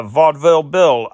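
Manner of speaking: ordinary talk, nothing marked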